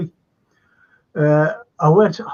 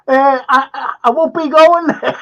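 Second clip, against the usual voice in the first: louder, higher mock protest; shouted; 7 semitones higher, 7 dB louder than usual. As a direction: call it out in mock horror. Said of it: "Shouted then".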